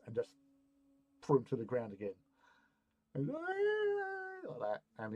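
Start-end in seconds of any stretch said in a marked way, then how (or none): 3.2-4.8 drawn-out like that. Retold or imitated. Dramatic end of the fight.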